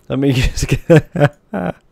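real giggles breaking up the sentence; laughing through words. Real, not acted. Streamer trying to fix the mod, unable to stop laughing.

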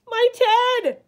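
high, loud startled exclamation; gasped. A narrator's reading. Bear spots his own Ted, gasping. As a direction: cry out in sudden shock. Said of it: "gasped the bear".

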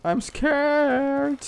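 high, slow sarcastic fake fright; mock scared. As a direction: pretend to be terrified, mocking him. Mock fear at threat.

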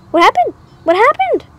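high-pitched mock panic; retold alarm. Child alarmed at friend leaping off the swing, acted panic.

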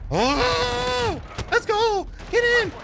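very high, drawn-out squealing cheers; real high-pitched excited screaming. Real, not acted. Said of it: "I sound like a little excited kid".

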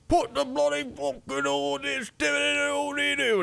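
very high, garbled mock British accent; cartoonish British builder gibberish. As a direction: imitate an English construction worker, high and unintelligible. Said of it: "some construction workers working on a building".

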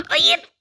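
high panicked cry of no; gasp into a shriek of no. Real, not acted. Gamer nearly dying, gasping shriek.

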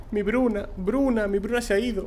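high, wailing mother calling for her lost daughter; frantic mother. Acted. Distraught mother begging the guards, high wailing. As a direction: cry out for the missing child, pitched high.

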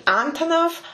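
Antonov with the first syllable punched high; stress on first syllable. Shown as a demonstration. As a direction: say Antonov as AN-tonov. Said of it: "He put the correct stress".

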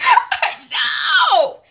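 high, loud laughing squeal; giggly squeal. Retold or imitated. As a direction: squeal with hammed-up giddy delight. Hadisa squeals over her crush.

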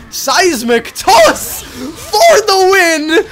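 slow, loud triumphant call; hyped shout. Real, not acted. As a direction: call the winning move out. Winning move, hyped.

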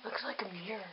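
hushed whisper. Real, not acted. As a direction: whisper it so mom won't hear. Whispering secretly.

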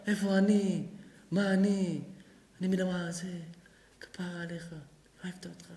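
fading to a soft tender close; loving wife. Acted. Wife sweetly softens her husband, fading soft. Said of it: "היא אומרת לך".

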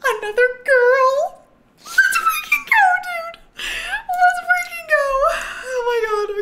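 very high, loud, crying-out celebration; real overjoyed squeal; slow, shrieking cheer; joyful shout. Real, not acted. Celebrating another girl kitten.

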